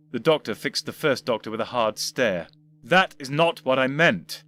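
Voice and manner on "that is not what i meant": slow, tight, restrained anger; through clenched teeth. Acted. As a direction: grind it out slowly. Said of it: "said slowly through clenched teeth".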